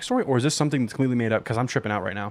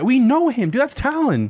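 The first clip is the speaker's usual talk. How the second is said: insistent; 12 semitones higher, 4 dB louder than usual. Real, not acted. Insisting to worried friend.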